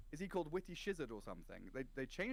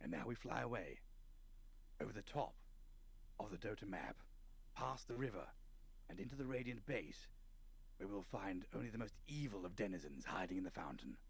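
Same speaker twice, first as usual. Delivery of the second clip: nature documentary=141; 5 semitones lower, 4 dB quieter than usual. Pretend. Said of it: "That's why you're such a good dungeon master".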